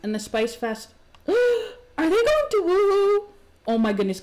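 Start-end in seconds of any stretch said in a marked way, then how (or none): 1.1-3.3 high, loud shocked squeak; shocked squeal. Real, not acted. Streamer shocked as her Sims couple sneak off together. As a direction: gasp it out in disbelief.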